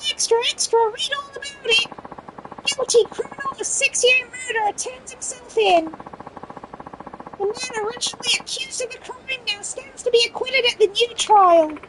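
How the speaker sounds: high, far newsboy shout; ragamuffin voice. Pretend. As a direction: call out like a street newsboy. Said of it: "I can do the ragamuffin voice".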